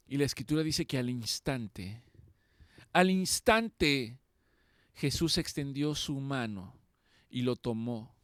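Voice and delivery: normal talking voice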